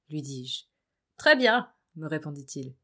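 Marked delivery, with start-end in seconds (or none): 1.2-1.7 higher and louder, upbeat; bright, cheerful answer. Acted.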